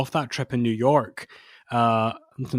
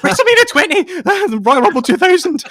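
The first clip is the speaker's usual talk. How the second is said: excited squeal; 12 dB louder than usual. Retold or imitated. Boy in a cab squealing at the Garden, high and loud. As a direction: squeal like an overexcited kid spotting a landmark.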